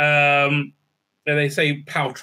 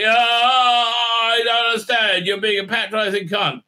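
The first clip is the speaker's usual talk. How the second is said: raised, hammed-up bewildered man; mock confused protest; 7 semitones higher than usual. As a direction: wail in exaggerated confusion.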